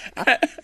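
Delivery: high burst of laughter; real laugh. Real, not acted. Laughs at her own story.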